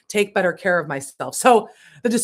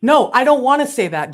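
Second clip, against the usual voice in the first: higher, louder protest; arguing back; 7 semitones higher, 9 dB louder than usual. Real, not acted. Arguing with her spirit guides, loud protest. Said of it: "how I argue with them".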